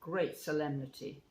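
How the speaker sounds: ordinary delivery, nothing marked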